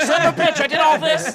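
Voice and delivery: yelled high and loud in frustration; a scheming chicken cursing its failed plan; furious chicken. Acted. Comic bit, chicken cursing its ruined breakup plot in a loud high yell. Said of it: "He's like".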